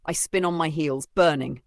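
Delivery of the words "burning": usual speaking voice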